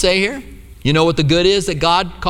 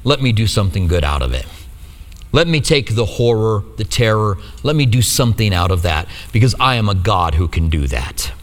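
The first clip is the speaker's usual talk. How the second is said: much lower, steady and assured; a low, reassuring promise; God speaking, understated; 9 semitones lower than usual. Retold or imitated. God promising good from horror, lower and assured. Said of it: "God is saying".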